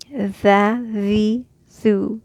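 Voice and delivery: tha, the, thu with a voiced tongue-out th; tha, the, thu repeated; vowel drill. Shown as a demonstration. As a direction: say each vowel form. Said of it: "Open your mouth".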